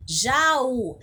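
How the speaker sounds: Jaú with the final Ú pushed hard; strong stressed U. Shown as a demonstration. Teacher shows pupils the tonic syllable, Jaú with a strong Ú. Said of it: "Ele é forte".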